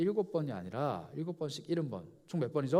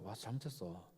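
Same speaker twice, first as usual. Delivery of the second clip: soft, lower mock-apologetic plea; mock apology; 7 semitones lower, 13 dB quieter than usual. Repeat offender begging forgiveness, a soft mock plea. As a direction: play the sheepish repeat offender.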